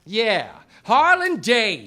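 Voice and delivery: loud, slow, dramatic accusation; calling out. Acted. Detective loudly names the suspect before the room, dramatic call-out. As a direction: bellow the suspect's name at him.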